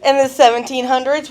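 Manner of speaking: usual speaking voice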